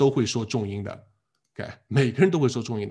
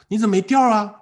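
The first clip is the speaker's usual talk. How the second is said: loud, higher, reproachful "why so flat?"; complaining question; 8 semitones higher, 6 dB louder than usual. Retold or imitated. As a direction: scold the student for sounding flat. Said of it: "说".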